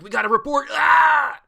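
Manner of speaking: very loud high yell of a fleeing grunt; loud high panicked cry; panicked shout. Retold or imitated. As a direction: scream it as a terrified henchman. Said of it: "They're like".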